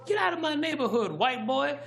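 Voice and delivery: put-on very high kid-voice yell; yelling little kid. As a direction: imitate a tiny kid yelling at an adult. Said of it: "So he started yelling".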